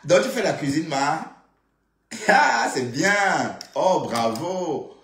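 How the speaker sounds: high, loud, stretched-out praise; gushing praise. Acted. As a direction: gush over her cooking. Gushing over Flore's cooking.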